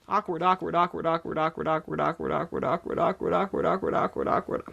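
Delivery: awkward said over and over, fading to a whisper; repeating awkward. Shown as a demonstration. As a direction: repeat awkward until it turns to mush. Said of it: "Say it with me".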